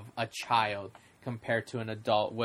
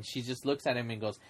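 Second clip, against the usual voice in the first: usual speaking voice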